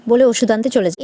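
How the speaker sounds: plain reading voice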